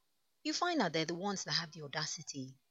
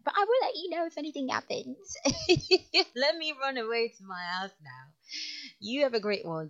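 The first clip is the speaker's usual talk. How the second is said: playful; 10 semitones higher, 10 dB louder than usual. Real, not acted. Playful sign-off.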